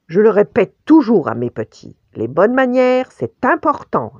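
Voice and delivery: loud, lecturing mother bear; ourse moralisatrice. A narrator's reading. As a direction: lay down the lesson, high and loud. L'ourse fait la leçon au lapin. (maman ourse qui fait la leçon)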